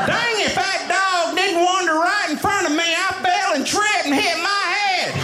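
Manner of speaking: put-on Southern accent. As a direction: complain in a hammed-up, high Southern accent. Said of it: "I sound like this".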